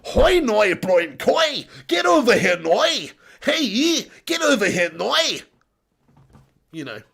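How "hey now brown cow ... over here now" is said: shouted mock Northern Irish accent; hammed-up Northern Irish yell. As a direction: call someone over in broad Northern Irish. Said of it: "do a Northern Ireland accent".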